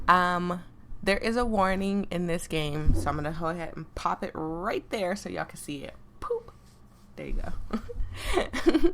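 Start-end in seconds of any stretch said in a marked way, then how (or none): 6.2-8.9 surprised. Real, not acted. Game warning popup mishap.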